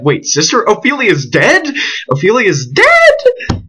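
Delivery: high, slow, crying out the question; wailing in shock at the news; stunned disbelief. Acted. Reader hears his sweetheart is dead, stunned crying disbelief. Reader learns Ophelia died.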